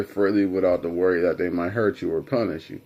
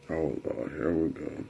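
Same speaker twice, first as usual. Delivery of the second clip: low, soft, weary groan of words; resigned aside; 5 semitones lower, 9 dB quieter than usual. Retold or imitated. Son dreading another round with Dad, resigned. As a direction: drop it under the breath, resigned.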